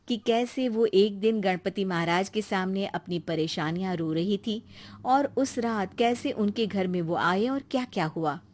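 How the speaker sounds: plain reading voice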